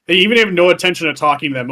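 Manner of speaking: usual speaking voice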